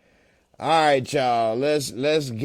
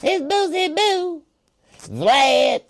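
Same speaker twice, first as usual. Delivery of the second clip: high-pitched [name] voice; [name] impression; 12 semitones higher, slower than usual. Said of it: "how his voice be getting all high pitched".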